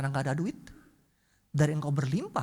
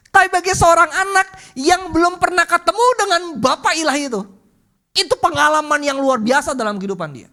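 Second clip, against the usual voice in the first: tearful preaching; 11 semitones higher, 13 dB louder than usual. Real, not acted. Preacher moved to tears.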